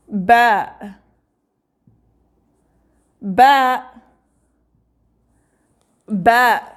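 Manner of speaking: Ba said loud and clear for repeating; teacher drilling Ba; letter name Ba. Shown as a demonstration. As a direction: model Ba slowly for students. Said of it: "Try to pronounce after me".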